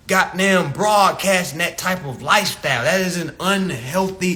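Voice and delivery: ordinary talk, nothing marked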